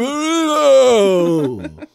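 high, very slow stretched-out shout of the word; mock excited shout. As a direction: draw the word out in mock delight. Mock echo of misheard word.

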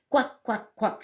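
high, short quacks; duck quacks. A narrator's reading.

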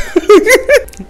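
high burst of real laughter. Real, not acted. Comedian cracking up at own joke, high squealing laugh.

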